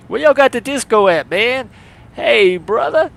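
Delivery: loud, high-pitched mock voice-acting demo. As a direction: put on a loud, high character voice.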